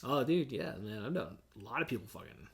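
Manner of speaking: ordinary delivery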